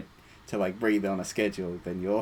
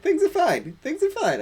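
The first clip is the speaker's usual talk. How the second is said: much higher, sing-song mocking chant; nagging inner voice; 5 dB louder than usual. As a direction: mimic a pesky voice repeating itself. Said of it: "there's this thing that keeps going, like".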